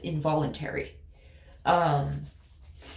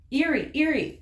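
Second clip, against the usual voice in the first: imitating friend; 8 semitones higher than usual. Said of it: "he will say".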